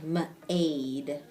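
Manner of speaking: "m-aid" split, m then long A; long A sounded out. Shown as a demonstration. Teacher replaying pupil's attempt, sounded out. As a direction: break "m-aid" after the m, drawing out the A. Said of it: "trying that long A sound".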